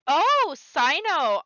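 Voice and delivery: drawn-out excited "Oh, Cyno!"; slow, gleeful recognition. Real, not acted.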